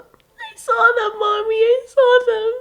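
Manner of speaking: put-on high crying child fading to faint; sob, then break down to a whisper; tearful insistence. Child telling her mother she saw it, crying.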